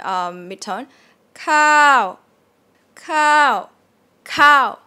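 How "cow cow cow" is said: "cow" high and loud with a drop; falling tone. Shown as a demonstration. Teacher drills a higher start, loud falling "cow". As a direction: start "cow" well above mid, then fall. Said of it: "Falling tone".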